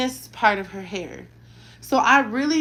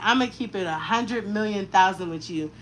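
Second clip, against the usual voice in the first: normal talking voice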